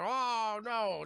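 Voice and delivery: retold gasp of dismay; mock dismay. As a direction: draw back in mock alarm. Retold alarm at gun being pulled.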